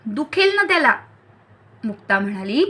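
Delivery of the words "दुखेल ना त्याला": high-pitched protest, barely raised voice; mild scolding cry. A narrator's reading. Mukta warns Pushkar off the sore arm, mild high yell. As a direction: call out a high warning to stop. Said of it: "Mukta ओरडली".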